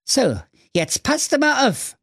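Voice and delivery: very high showman son; youngest son voice. Acted. Youngest son announcing his magic, very high. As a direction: announce the trick. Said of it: "Der Klaus drei sprach".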